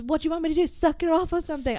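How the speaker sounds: put-on Irish accent not quite landing; failed Irish accent. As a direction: try an Irish accent and barely manage it. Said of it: "I can't do an Irish accent".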